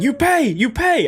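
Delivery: put-on high, slow Spanish-accented insisting; mock Spanish demand. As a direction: press him in a Spanish accent. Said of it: "grabbed me and went".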